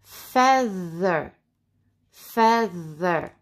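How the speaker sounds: FEATHER stretched, with a buzzing th; voiced TH. Shown as a demonstration. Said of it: "Feather is like weather".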